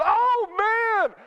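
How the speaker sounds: high, loud wail of frustration; exasperated mock yell. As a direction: cry out in hammed-up despair. Acted outburst as light turns yellow.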